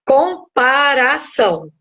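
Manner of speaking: "comparação" slowly, broken into com-para-ção; split into syllables. Shown as a demonstration. Preacher breaking the word down for listeners, "comparação" syllable by syllable. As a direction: say "comparação" in separate, drawn-out syllables. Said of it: "eu separo isso em sílabas".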